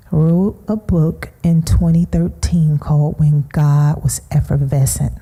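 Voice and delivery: ordinary talk, nothing marked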